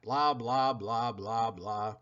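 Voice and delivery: fading, dropping blah blah; dismissive blah. Acted. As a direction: brush it off, trailing away. Dog skipping over her story.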